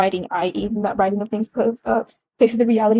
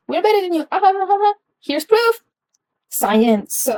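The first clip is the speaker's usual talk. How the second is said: high, gloating mock voice with a cackle; mocking superiority; 8 semitones higher than usual. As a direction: sneer it like a smug colonizer. Mocking European superiority, high laugh.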